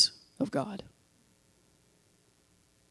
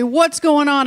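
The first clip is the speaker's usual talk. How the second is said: high, loud mock demand; raised, pitched-up imitation of a parent; lightly voiced alarmed parent; 6 semitones higher, 6 dB louder than usual. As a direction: call out like a parent who heard a crash. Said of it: "your parents hear a loud noise and they say".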